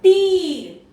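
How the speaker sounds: तीर said high and loud with a long ई. Shown as a demonstration. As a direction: ring out तीर with a lifted ई. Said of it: "plus ई की मात्रा plus रा". (तीर को ऊंचे स्वर में)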